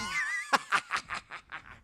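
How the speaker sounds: long hammed-up supervillain cackle; drawn-out mock evil laughter; evil villain laugh. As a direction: laugh maniacally like a cartoon villain. Villain laugh ending the monologue.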